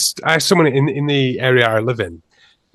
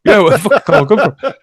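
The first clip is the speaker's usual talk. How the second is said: laughing; 8 semitones higher, 7 dB louder than usual. Real, not acted. Laughing at his own question.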